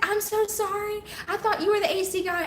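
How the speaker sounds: high-pitched apologetic mom voice; imitating mom. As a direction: play mom's sheepish apology high. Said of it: "And she's like".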